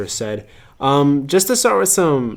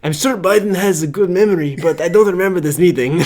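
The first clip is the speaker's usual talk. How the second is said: put-on Putin-style Russian accent, a bit higher; deadpan Russian accent, slightly raised; 6 semitones higher, 4 dB louder than usual. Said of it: "I guess I do the Russian accent".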